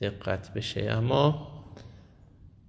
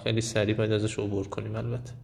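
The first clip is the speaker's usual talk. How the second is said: ordinary talk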